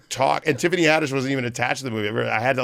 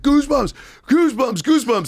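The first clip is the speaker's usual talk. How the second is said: high panicked yell repeated, louder; 13 semitones higher, 4 dB louder than usual. Retold or imitated. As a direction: scream the wrong safe word in terror.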